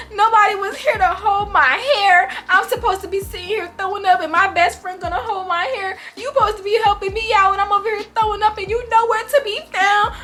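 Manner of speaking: high-pitched hammed-up indignant wail; mad, dramatic rant. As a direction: re-enact a wronged drunk girl's tirade, voice held way up. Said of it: "I was so mad".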